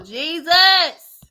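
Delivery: loud, high-pitched "Jesus!"; exasperated exclamation. Real, not acted. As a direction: blurt it out in disbelief.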